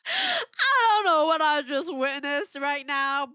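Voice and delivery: slow, high, tearful laughing voice; real laughter-tears in the voice. Real, not acted. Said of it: "I have some tears".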